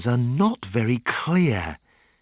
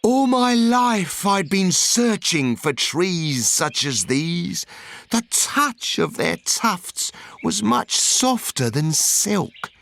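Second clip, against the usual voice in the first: higher, brighter, rapt with delight; awed wonder; 5 semitones higher than usual. Acted. Onceler remembers first seeing the truffula trees, higher and awed. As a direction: sing out the wonder of first sight. Onceler's joyful memory continues.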